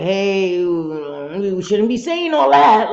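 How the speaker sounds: drawn-out loud "hey" rising to a protest; big, stretched-out objection; mock chiding call-out. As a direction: call out slowly and loudly to stop them. Acting out scolding the chat.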